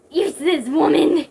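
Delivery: loud, mildly shrill villain; lightly shrill sneer; high, shrill. A narrator's reading. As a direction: spit out a villain's insult, a touch high. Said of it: "he said with a high, shrill voice".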